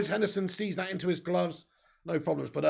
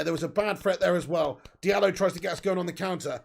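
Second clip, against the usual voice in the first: normal talking voice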